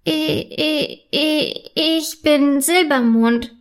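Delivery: startled fairy stuttering on the first word; stammering. A narrator's reading. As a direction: stumble haltingly into the introduction. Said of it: "stammelt Silbermond".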